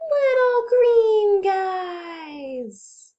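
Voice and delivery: high, loud, very slow wonder; dramatic reveal. Pretend. Teacher revealing the little green aliens to the kids in wonder. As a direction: stretch it out in awe. Big reveal at book end.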